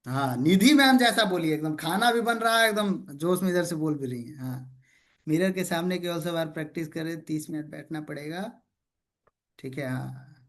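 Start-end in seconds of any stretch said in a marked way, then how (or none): none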